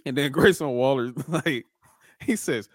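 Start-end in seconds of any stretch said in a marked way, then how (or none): none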